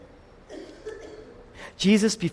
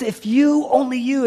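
high, raised, urgent appeal; single them out with a pitched-up, emotional cry; emotional calling out; 9 semitones higher, 5 dB louder than usual. Acted. Jesus calling out to the city, high and emotional. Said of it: "this is emotional".

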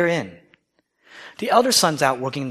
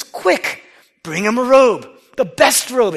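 high, loud eager command; urgent father; 8 semitones higher, 6 dB louder, slower than usual. Acted. Father orders servants for his returned son, excited and loud. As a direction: hurry the servants, bursting with joy.

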